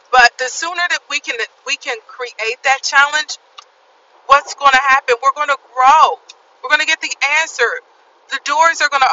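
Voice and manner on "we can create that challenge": ordinary talk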